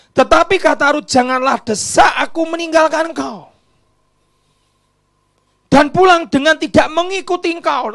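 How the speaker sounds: high, loud pleading quote; loud pleading reading; impassioned shout. Acted. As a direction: cry it out like Ruth. Dramatic Bible reading.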